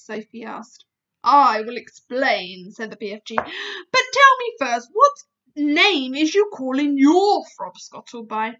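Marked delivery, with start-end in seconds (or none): 1.2-8.6 booming giant, patient; explaining giant. Acted. BFG slow, loud explaining.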